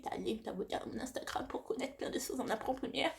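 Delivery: slushy lisp on the s sounds; s sounds slip toward sh. Real, not acted. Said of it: "excusez-moi si je zozote".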